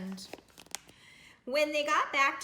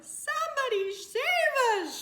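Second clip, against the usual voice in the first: much higher frightened squeal; mock shriek; 7 dB louder than usual. A narrator's reading. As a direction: shriek for help, playful fright. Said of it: "shrieked the dogs".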